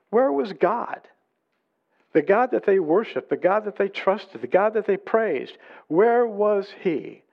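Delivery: high, insistent protest; grumbling complaint. Acted. The people grumble about the God they trusted, high and insistent. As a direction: demand it, stretching the final question. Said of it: "They grumbled".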